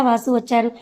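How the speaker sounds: usual speaking voice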